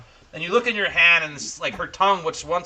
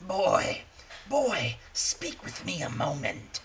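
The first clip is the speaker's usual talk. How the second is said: lightly voiced ancient crone, beckoning; old crone; 8 dB quieter, slower than usual. Old crone stopping a young man on the road, lightly voiced. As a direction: call the boy over like a frail old hag. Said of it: "she says".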